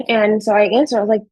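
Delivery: usual speaking voice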